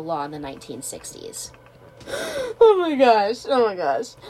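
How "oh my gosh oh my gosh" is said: surprised. Real, not acted. Shocked by the story.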